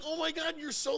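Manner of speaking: high, marvelling exclamation; retold amazement. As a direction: re-enact being amazed, pitched high. Retelling amazement at grown-up Matt.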